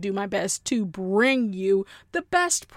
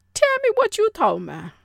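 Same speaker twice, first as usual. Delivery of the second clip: higher, louder, quicker title shout-out; segment title announcement; 12 semitones higher, 7 dB louder, faster than usual. Pretend. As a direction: announce it big and high, like a show segment.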